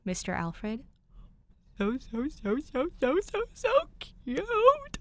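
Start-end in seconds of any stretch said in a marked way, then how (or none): 1.8-5.0 rising squeal into a slow high cute; gushing squeal. Real, not acted. Reader squealing at fan art, gushing. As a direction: melt over something adorable.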